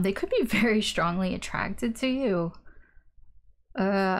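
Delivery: usual speaking voice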